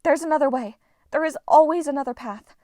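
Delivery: high, loud firm insistence; insisting. A narrator's reading. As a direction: refuse to accept it. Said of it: "Hazel insisted".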